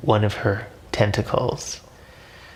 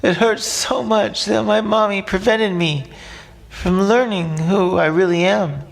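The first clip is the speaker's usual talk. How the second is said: put-on crying like a baby; 10 semitones higher than usual. A narrator's reading. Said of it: "Majib cries like a baby".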